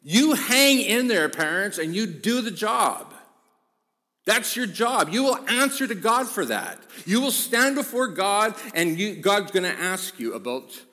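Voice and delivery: loud, high, emphatic pulpit exhortation; preaching at full force. Real, not acted.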